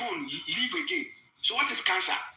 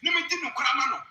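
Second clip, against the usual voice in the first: raised, higher Twi preaching voice; fervent preaching; 5 semitones higher than usual. Acted. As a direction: preach it to the congregation with fire. Preaches in Twi at full force.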